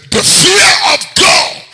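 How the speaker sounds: slow, high, shouted proclamation; booming. Acted. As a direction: proclaim it slowly at full voice. Preacher booms scripture line.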